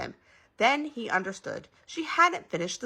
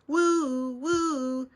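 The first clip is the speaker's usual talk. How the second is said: high, sustained police siren imitation; long hooting siren sound; siren wail; 7 semitones higher, 4 dB louder than usual. A narrator's reading. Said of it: "heard a siren".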